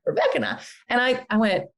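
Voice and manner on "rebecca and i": put-on laughing Tallahassee accent, pitched up; one more laughing bit of Gene's Tallahassee accent. Host laughs as she repeats Gene's Tallahassee accent. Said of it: "like, a Tallahassee accent".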